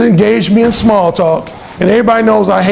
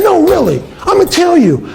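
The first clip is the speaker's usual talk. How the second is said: higher mock woman's voice; earnest roommate; 9 semitones higher than usual. Alan imitating the roommate explaining herself, pitched up. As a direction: insist in a raised girly voice.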